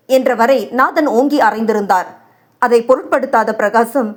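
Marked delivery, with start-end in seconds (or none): none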